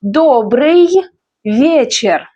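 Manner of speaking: Добрый held long, pause, then вечер; slow word-by-word Добрый вечер; repeat-after-me model. Shown as a demonstration. Teacher has learners repeat Добрый вечер, slow and separated. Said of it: "Repeat after me".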